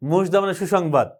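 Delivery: normal talking voice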